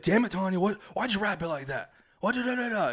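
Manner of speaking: mock rant at the wife over the wrapping; angry dad. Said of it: "He's a very angry man".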